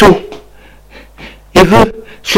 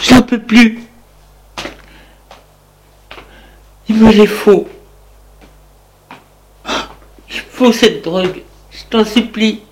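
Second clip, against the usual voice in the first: desperate begging; 6 semitones higher than usual. Acted. Wife begging again.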